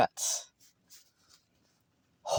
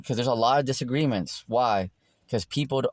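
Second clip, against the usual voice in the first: ordinary talk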